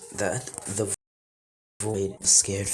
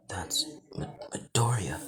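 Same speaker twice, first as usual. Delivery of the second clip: hushed start breaking off, then higher on the name; stunned; 4 semitones higher, 3 dB quieter than usual. A narrator's reading. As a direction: stammer the name in mild shock. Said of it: "he's surprised as hell".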